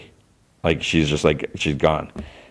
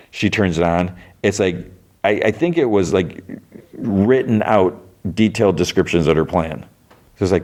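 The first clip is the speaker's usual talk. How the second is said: normal talking voice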